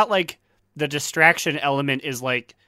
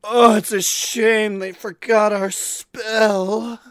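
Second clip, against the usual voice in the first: sultry demon voice; 6 semitones higher, slower than usual. Retold or imitated. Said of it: "They're just like".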